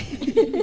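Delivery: short rising laugh. Real, not acted. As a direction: chuckle at yourself. Ríe de su propia oración. (risita breve)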